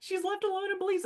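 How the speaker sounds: high, panicky group voice; panicked. Retold or imitated. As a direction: squeal it out in alarm. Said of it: "They're like".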